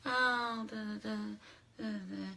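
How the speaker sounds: tiny, hushed dainty babble; mock your own sweet act very softly; barely-there demure voice; cute act. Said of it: "all you think that I'm".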